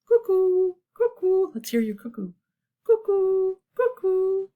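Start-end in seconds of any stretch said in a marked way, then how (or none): 0.0-1.5 high, loud two-note cuckoo; cuckoo call. A narrator's reading. Reader voicing the cuckoo for children, calling. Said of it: "The cuckoo called". 2.8-4.5 high two-note bird call; be a cuckoo, high and clear. A narrator's reading. Inviting children's cuckoo, high calling. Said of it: "The cuckoo called".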